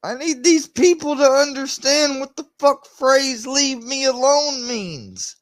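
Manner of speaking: high-pitched, real exasperated pleading; exasperated demand. Real, not acted. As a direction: demand it at the end of your tether, voice way up high. Exasperated demand to be left alone.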